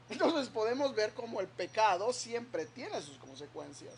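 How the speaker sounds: normal talking voice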